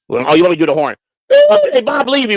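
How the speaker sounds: usual speaking voice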